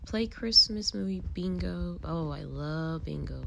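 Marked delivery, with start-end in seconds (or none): none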